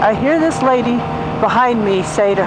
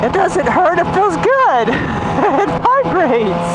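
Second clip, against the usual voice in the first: higher-pitched, giggly imitation; tickled, high-pitched mocking quote; gleeful mock reply; 8 semitones higher, slower than usual. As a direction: put on a high, delighted voice and laugh through it. Mimics someone's reply with glee.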